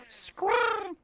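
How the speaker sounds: very high-pitched creature squawk; imp's startled squawk. Acted. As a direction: squawk like a startled little imp. Voicing the startled imp's cry.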